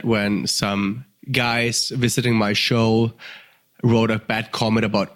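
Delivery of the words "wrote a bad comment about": ordinary talk, nothing marked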